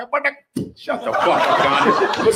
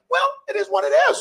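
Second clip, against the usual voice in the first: high, fast mock shrug; high dismissive mock resignation; shrugging; faster than usual. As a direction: brush it off in a high voice. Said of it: "everyone's like".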